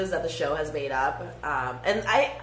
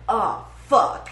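loud, higher repeated oh fuck; mock exclamation; 5 semitones higher, 8 dB louder, much slower than usual. Imitating a character reacting to porn, loud. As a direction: blurt it out over and over. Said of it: "the guy's just always like".

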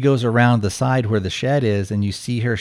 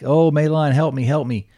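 higher, louder cry for help; mock pleading; 6 semitones higher, 3 dB louder than usual. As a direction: plead like he's losing control. Retold character pleading for help.

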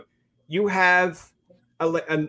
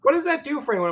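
mock critic; 11 semitones higher than usual. Quoting a dismissive critic.

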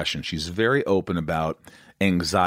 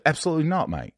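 higher-pitched, bad Australian accent; terrible Australian accent; 8 semitones higher, faster than usual. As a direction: do a badly faked Australian accent. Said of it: "terrible Australian accent I'm doing".

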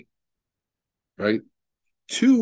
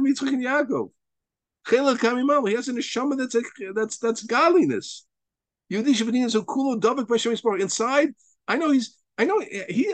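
high, ringing sermon voice pitched up; fervent preaching; 9 semitones higher than usual. Acted. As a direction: preach it with rising fire.

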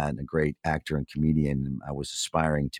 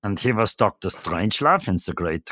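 put-on silly German accent; Doctor Strangelove. Describing Sellers in the Kubrick film, silly German accent. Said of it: "silly, uh, German accent".

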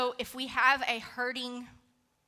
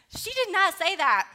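emphatic; 8 semitones higher, 9 dB louder than usual. Real, not acted. Emphatic punchline in story.

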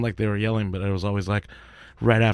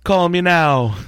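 higher, louder Jamaican psychic-hotline pitch; Miss Cleo Jamaican; 7 semitones higher, 6 dB louder, much slower than usual. Retold or imitated. Doing Jamaican accent for live chat request, louder and higher. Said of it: "it's always Miss Cleo".

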